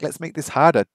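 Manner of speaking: ordinary talk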